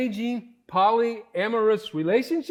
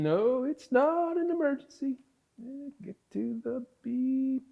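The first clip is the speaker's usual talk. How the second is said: ordinary talk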